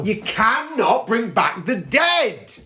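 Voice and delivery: slow, high and loud; emphatic repeat. Acted. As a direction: hammer home the point.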